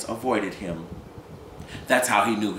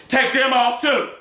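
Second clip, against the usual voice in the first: harsh order; 7 dB louder, slower than usual. Acted. Gus orders.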